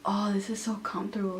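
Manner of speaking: soft breathy whisper. Retold or imitated. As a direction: whisper it contentedly. Friend lying on backpack.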